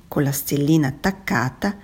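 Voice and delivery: usual speaking voice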